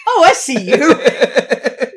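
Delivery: slow, drawn-out, laughing Australian "Oh, I see you"; mock Australian accent. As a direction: ham up a slipping Australian accent. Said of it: "You're like".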